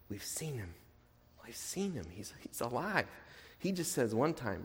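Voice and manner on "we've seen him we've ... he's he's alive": hushed, breathy insistence, dropping almost to nothing; urgent whisper. Retold or imitated. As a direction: whisper it like a secret you can't keep. Disciples insisting to doubting Thomas.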